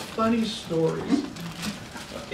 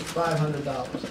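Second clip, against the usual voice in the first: lower, softer, slow gruff bid; a hint of a stern cowboy; 6 semitones lower, 6 dB quieter than usual. Stern cowboy bidder at the auction, lightly voiced low.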